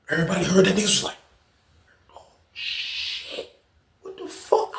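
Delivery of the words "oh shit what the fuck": hushed whisper. Retold or imitated. Onlookers react in hushed shock.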